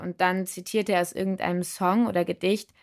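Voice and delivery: plain reading voice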